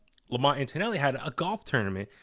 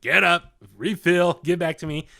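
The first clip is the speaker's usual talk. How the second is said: put-on loud, higher bossy little-kid call; mock toddler demand; 5 semitones higher, 6 dB louder than usual. Toddler calling to his aunt for milk, loud and bossy. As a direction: bark it like a thirsty toddler wanting more.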